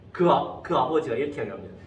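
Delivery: ordinary delivery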